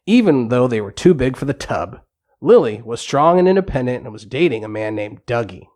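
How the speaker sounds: ordinary delivery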